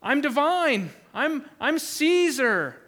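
mocking a ruler who claims divinity; mock proud ruler. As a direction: puff up like a self-made god. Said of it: "there's men walking around saying".

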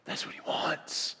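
slow breathy whisper, barely voiced. Real, not acted. Pastor whispering God's desire to the congregation. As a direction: lean in and whisper it to them. Repeats line, drops to whisper.

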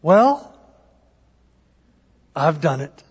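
smug. Retold or imitated. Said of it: "I thought to myself".

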